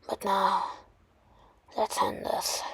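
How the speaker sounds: hushed villain's threat; quiet menace. Acted. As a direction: drop to a near-whisper to threaten. Villain's threat before final attack.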